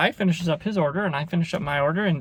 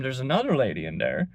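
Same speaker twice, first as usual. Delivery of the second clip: normal talking voice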